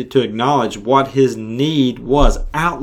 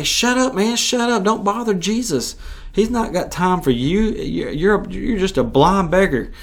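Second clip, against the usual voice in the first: scornful crowd; 7 semitones higher than usual. Retold or imitated. Crowd silencing the blind man.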